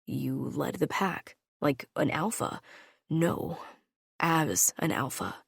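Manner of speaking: low and soft, proud; soft tender. A narrator's reading. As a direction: say it gently, with pride. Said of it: "he asked me softly".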